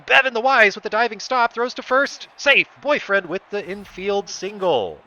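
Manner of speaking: loud, high, fast-rising call of the dive; excited play call. Real, not acted. Commentator calling a diving stop at first, excited. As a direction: shout the close play like it matters.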